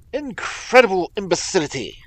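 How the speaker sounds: loud, high burst of contempt; outraged cry. A narrator's reading. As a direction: explode at the police's folly. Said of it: "he cried".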